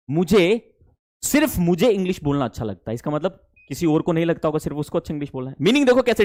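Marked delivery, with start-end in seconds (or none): none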